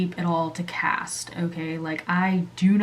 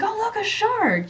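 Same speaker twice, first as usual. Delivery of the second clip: stepdad pointing out; 14 semitones higher than usual. Retold or imitated. Stepdad pointing at the water, imitated high-pitched call-out. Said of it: "he says it kinda like that".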